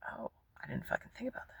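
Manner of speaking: fast, breathless whispered admission; hushed, embarrassed aside; sheepish whisper. Retold or imitated. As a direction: confess it under your breath, caught out. Sheepish realisation after being questioned.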